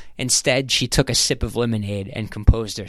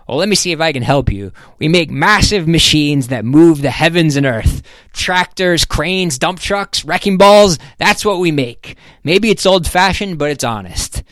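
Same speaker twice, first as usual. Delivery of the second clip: higher, emphatic boast, item by item; proud businessman; 6 semitones higher, 5 dB louder than usual. Acted. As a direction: pitch the company with plain-spoken pride. Voices Ham's proud company pitch.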